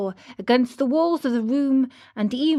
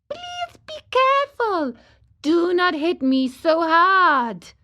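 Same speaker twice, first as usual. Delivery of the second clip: tiny high voice, pleading, slowing down; a little voice begging not to be hit; wee voice beseeching; 8 semitones higher, 4 dB louder than usual. A narrator's reading. Wood's tiny voice begs the carpenter, high pleading. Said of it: "in a beseeching tone".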